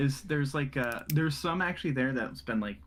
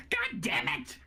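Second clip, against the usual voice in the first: high angry outburst; exasperated curse; slower than usual. Acted. Noble hears vampires attack the church, high curse. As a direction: spit out the curse in alarm. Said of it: "And he goes".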